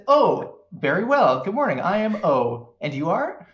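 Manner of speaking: a lightly voiced cheerful android. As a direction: greet them like a freshly booted android.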